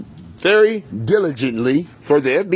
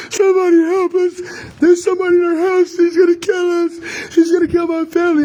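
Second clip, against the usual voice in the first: retold panicked scream; 13 semitones higher than usual. Calling to neighbors while a gunman is inside, high terrified screaming. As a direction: beg the neighbors for help as if your life hangs on it. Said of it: "and I'm screaming for help".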